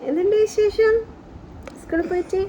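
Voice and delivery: mother greeting her daughter back from school; cheerful greeting. Real, not acted. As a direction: greet your little one with delight.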